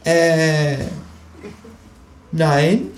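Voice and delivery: high, hesitant kangaroo voice; caught kangaroo. Acted. Kangaroo caught with its paw in the food, high and hesitant. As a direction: deny it lamely as the kangaroo. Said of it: "sagt das Känguru".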